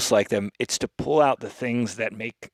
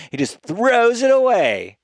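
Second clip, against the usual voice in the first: exasperated emphasis; 10 semitones higher, 4 dB louder than usual. Real, not acted. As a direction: stretch THROWS in disbelief. Exasperated repeat of his complaint.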